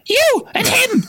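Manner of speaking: put-on high, shrill girl's shriek; Hermione shrieking. A narrator's reading. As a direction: shriek it as a furious teen girl. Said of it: "Hermione shrieked".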